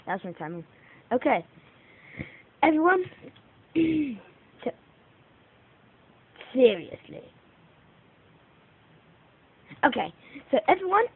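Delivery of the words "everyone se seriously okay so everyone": high, loud host call; outro address. Real, not acted. Youtuber opening his outro, loud. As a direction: call out to the viewers.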